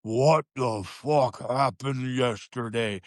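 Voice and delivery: slow, drawn-out imitation of a deep-voiced coach; imitating a deep voice. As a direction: play the gruff coach, taking every word slowly. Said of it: "he's got that real deep voice".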